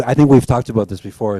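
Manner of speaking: ordinary talk, nothing marked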